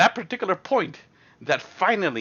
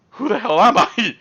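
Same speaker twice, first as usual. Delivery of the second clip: mock disbelief; 7 semitones higher, 6 dB louder than usual. Shocked disbelief.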